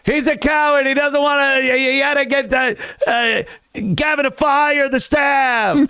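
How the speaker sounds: high-pitched wailing accusation impression; Brendan. Mocking Brendan calling someone a coward, high-pitched imitation. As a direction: mimic an indignant rant way up in pitch. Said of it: "as Brendan".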